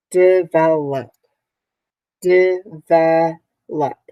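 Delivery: "Develop" drawn out slowly, syllable by syllable; slow, careful "Develop" for young learners; slow syllable demo. Shown as a demonstration. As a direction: stretch out "Develop" so each of its syllables is heard. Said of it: "Three syllables".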